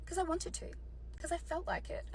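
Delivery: soft, unbothered imitation of husband; straight-faced. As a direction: toss it off softly with no remorse. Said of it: "straight face, like a sociopath".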